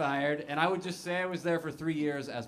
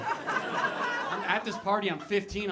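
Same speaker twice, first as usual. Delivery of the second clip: ordinary talk, nothing marked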